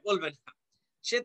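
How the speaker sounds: ordinary talk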